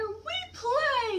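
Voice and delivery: very high little chipmunk voice; baby chipmunks. Acted. Baby chipmunks answer their mother, very high. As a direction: squeak it like tiny chipmunks.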